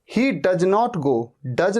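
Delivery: does as "duj" in place of "duz"; students' j mistake. Shown as a demonstration. Teacher showing the mistaken "duj" in a sentence. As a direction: end does on a j sound. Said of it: "does नहीं".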